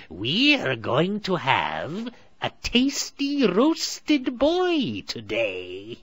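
high, very slow, loud relish; gloating witch. A narrator's reading. Witch gloats to Gretel, slow and greedy. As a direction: savour every word like a hag. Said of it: "grew tired of waiting".

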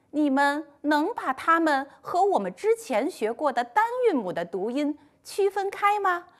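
ordinary talk, nothing marked

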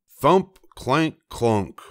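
storybook sound effects. A narrator's reading. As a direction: punch the first thud, then let it settle. Narrator imitates clattering fall.

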